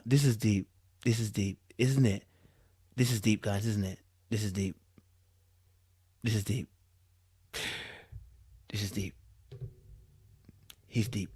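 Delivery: sarcastic hushed awe fading away; mock awe. Streamer mocks the deep statement in hushed awe.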